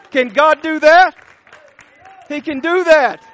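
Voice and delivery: loud, high, fervent pulpit delivery; full-force preaching. Real, not acted. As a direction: drive it home to the whole congregation.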